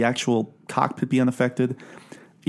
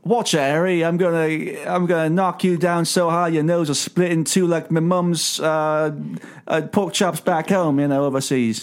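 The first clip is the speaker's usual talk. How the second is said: higher-pitched comic British threat; mock British accent; 4 semitones higher than usual. Host joining the riff on Nick's slang, mock British accent. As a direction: bluster in a badly faked British accent. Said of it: "other British slang".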